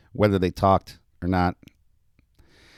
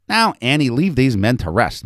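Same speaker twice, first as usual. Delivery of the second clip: firm, louder grandmotherly order; insistent; 6 semitones higher, 6 dB louder than usual. A narrator's reading. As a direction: insist to the child with raised pitch. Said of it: "Missus Hamburg insisted".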